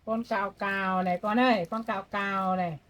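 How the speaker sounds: put-on loud, sing-song talk to a toddler; grandma playing and showing toys to a baby. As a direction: coax the baby, calling out brightly.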